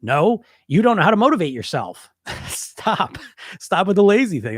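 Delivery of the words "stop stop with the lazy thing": breathy laugh through the word. Real, not acted. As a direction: laugh it out, amused. Laughing at the "lazy" excuse.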